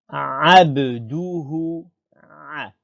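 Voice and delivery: slow Abduhu with a throat-squeezed Arabic ain; ain onset. Shown as a demonstration. Teacher calling for attention, Abduhu with a strong ain. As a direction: start Abduhu from the throat, drawn out. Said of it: "c'est ain".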